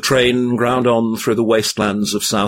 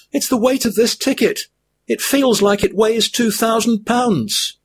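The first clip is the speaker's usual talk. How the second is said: high weary hobbit voice; a high-pitched hobbit worn out by his burden; weary Frodo; 13 semitones higher than usual. A narrator's reading. Frodo's held figure voice.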